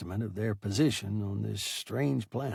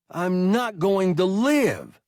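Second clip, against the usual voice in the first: higher, louder, slow whine; a slower, high whining repeat; same whining tone; 9 semitones higher, 7 dB louder, slower than usual. A narrator's reading. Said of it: "repeated in exactly the same tone".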